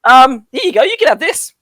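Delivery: high, loud little fairy; pixie voice. Acted. Pixie hands over a bloody whistle, high and loud. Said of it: "and they go".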